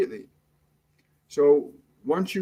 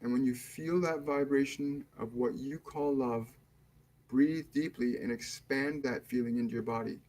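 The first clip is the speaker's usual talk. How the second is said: guided meditation; 4 dB quieter than usual. Acted. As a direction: ease them into calm, unhurried and hushed. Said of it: "we're going to start the meditation now".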